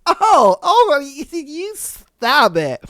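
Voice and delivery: high, slow bashful delight; flattered and giddy. Retold or imitated. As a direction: act coy and flattered. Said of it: "And you're just like".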